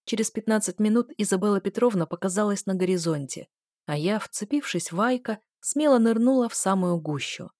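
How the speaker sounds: usual speaking voice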